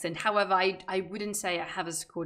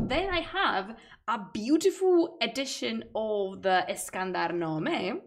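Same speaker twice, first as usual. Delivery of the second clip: ordinary talk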